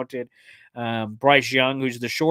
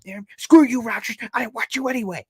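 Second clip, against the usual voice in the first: high, loud fake fury; hammed-up bitter outburst; mock angry rant; 10 semitones higher, 6 dB louder than usual. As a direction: rant up high like a jilted fan. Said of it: "I'm like".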